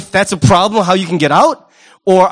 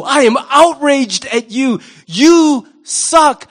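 mock outrage; 4 semitones higher, much slower than usual. Preacher playing a hypocrite scolding someone, in slow mock outrage. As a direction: stretch out the fake indignation.

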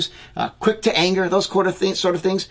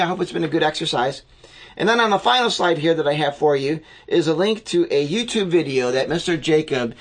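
ordinary talk, nothing marked

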